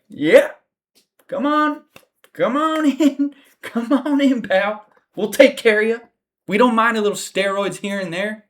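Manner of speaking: high, mock-friendly salesman voice; mocking UFC welcome. Mocking the UFC welcoming a steroid user, in a high cheesy voice. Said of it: "U F C's like".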